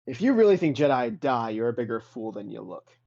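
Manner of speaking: a stately ghostly master lecturing; lofty Jedi spirit. Acted. Spectral Jedi master lectures Adrian, lofty and scornful. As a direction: pace and talk down to the fool.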